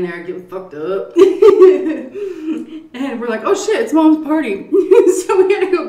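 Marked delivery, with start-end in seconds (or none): 1.2-2.9 loud, high burst of laughter; laughing hard. Real, not acted. Laughs at getting drunk story. 3.0-5.9 loud, high, talking through laughter; laughing. Real, not acted. Laughing while telling story.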